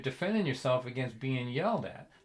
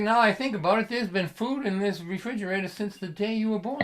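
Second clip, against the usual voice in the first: higher-pitched, indignant dad voice; lecturing father; 6 semitones higher, 3 dB louder than usual. Retold or imitated. Dad lecturing his son about food in the fridge, pointed.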